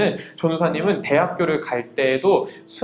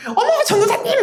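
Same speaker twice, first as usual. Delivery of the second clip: very high, gushing middle-aged woman; mock an over-friendly auntie's loud squeal of greeting; loud chatty evangelist; 4 dB louder than usual. Middle-aged evangelist spotting an acquaintance, loud gushing impression. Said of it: "목소리 크고 말 많은 전도사님이".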